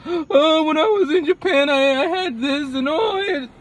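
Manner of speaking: high, breathy-free gushing reminiscer after a gasp; mock nostalgic gush. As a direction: gush dreamily about the old days. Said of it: "I'm not like".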